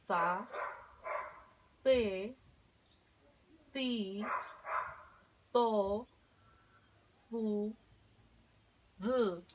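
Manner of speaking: "za, te, ti, to, fu, z" con la lengua entre los dientes; sílabas con d interdental. Shown as a demonstration. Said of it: "vamos a practicar también". (práctica de sílabas entre dientes)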